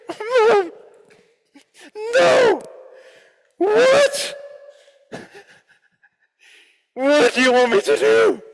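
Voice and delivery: very high, loud frustrated plea; exasperated protest. Retold or imitated. Son exasperated with his mother, very high and loud. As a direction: throw up your hands in despair.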